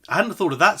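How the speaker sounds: slightly posh father. Shown as a demonstration. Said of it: "bit posh maybe".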